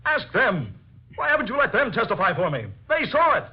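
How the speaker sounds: high, urgent, almost shrill begging; cling to your last hope, pleading hard; desperate pleading. Acted. Pleads for witnesses to testify.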